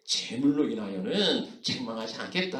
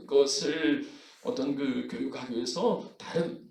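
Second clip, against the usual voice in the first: ordinary delivery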